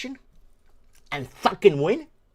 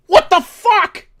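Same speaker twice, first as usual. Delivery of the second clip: slow, higher, loud yell of disbelief; outraged yell; 12 semitones higher, 12 dB louder, much slower than usual. Real, not acted. Host outraged at the election result, slow outraged yell. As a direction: drag it out in furious disbelief.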